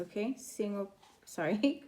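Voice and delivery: usual speaking voice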